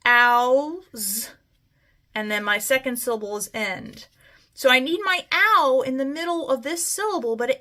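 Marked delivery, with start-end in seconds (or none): 0.1-1.3 "ows" held and stretched; sounded out. Shown as a demonstration. Teacher to class, stretching the ow in "ows". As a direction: draw out the ow in "ows". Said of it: "So I hear my th".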